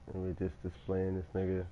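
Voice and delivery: normal talking voice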